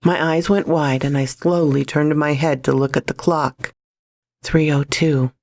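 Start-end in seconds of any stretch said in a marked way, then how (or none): none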